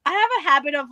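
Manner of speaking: ordinary delivery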